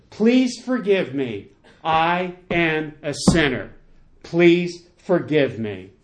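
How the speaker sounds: slow, weighted plea; emphatic confession. Retold or imitated. Preacher voices the sinner asking the king's pardon, slow emphatic confession. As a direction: set down each word slowly and firmly.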